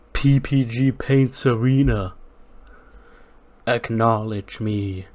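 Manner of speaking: slow, drawn-out arena demand; lightly imitated wrestling champion demanding respect; a champion speaking to the crowd, slow and commanding. Roman Reigns addressing arena.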